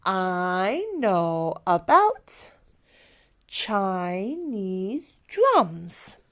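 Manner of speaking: slowed right down, drums drawn out; slow teacher modelling, word by word; slow model sentence. Shown as a demonstration. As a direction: say it slowly so kids can follow. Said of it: "Go ahead, try that".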